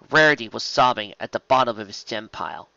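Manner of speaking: plain reading voice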